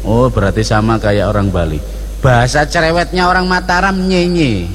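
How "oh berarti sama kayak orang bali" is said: normal talking voice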